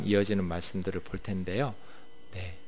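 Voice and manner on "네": normal talking voice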